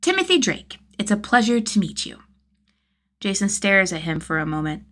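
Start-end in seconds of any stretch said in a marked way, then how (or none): 0.0-2.2 much higher, stiff and overly polite; little robot putting on manners; overpolished formal kid. Acted. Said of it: "overpolished smile".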